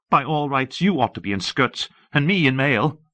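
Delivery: high-pitched, cutting contempt; contemptuous queen. A narrator's reading. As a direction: taunt him in the queen's high voice. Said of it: "Cersei's face was a study in contempt".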